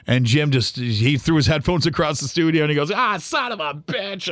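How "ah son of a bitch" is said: slow, drawn-out curse, held back in volume; exasperated curse. Retold or imitated. Coworker hearing of the firing, mock exasperated curse. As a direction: imitate a frustrated outburst, higher but softer. Colleague throws headphones on hearing news.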